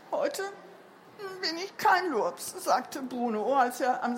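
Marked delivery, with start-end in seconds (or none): 0.1-2.5 put-on subdued, halting child's announcement; feeble child. A narrator's reading. Pale Bruno tells Mama in the kitchen, feebly. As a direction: hesitate after the first word, weakly. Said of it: "Er war ganz blass".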